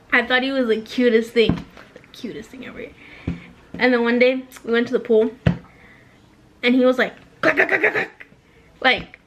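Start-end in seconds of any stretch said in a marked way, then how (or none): 1.9-2.9 soft whispered aside to viewers. Real, not acted. 7.4-8.3 imitated clacking. Imitating ab sounds.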